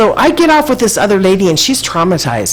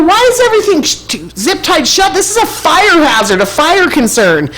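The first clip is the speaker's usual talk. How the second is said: retold complaint; 11 semitones higher than usual. Complaining to the ticket lady about locked doors, high indignant rant. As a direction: scold the clerk about the fire hazard.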